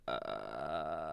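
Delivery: low creaking croak from the throat; a deep vocal fry croak; grudge noise. Shown as a demonstration. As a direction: make the creepy Grudge death rattle. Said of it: "I can do the grudge noise".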